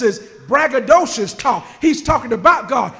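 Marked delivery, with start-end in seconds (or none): none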